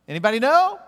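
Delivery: high, loud and fast quiz-host call; loud game-show quiz. Acted. Teacher quizzing the class on Hebrew letters, loud and high.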